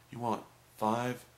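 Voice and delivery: drawn-out, emphatic clerk's question; slow careful repeating. Retold or imitated. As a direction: spell it out word by word for confirmation. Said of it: "I kept repeating it".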